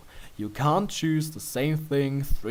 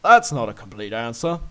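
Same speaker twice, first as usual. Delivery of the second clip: playful chiding; 8 dB louder than usual. Acted. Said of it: "you chide playfully".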